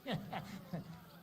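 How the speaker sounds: soft, breath-only chuckle. Acted. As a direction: chuckle quietly to yourself. Asked what is so funny.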